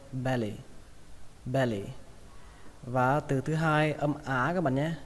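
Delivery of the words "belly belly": "belly" said as be-ly with one L; single L. Shown as a demonstration. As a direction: treat the double L in "belly" as one. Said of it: "tưởng tượng là có một chữ L thôi". (đọc "belly" như chỉ có một chữ L)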